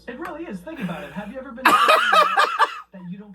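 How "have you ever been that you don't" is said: words break into loud laughter; can barely get it out for laughing; laughter bursting into a shout; laughing hard. Real, not acted. Cracking up at own joke.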